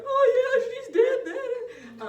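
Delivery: high, loud, drawn-out fake wail; mock crying. Resident realising her mother is dead, hammed-up crying. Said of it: "she could have started crying, going".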